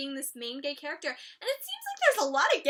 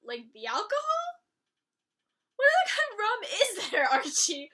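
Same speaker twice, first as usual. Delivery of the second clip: high laughing mock; amused mock; 5 semitones higher, 4 dB louder than usual. Vlogger laughing through Hiram and Archie's rum exchange. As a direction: crack up at the rum line. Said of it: "Archie's like".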